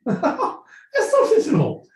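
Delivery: very high, slow, laughing royal British; royal British accent. Acted. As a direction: draw it out in a falsetto mock-British laugh. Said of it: "in the royal British accent".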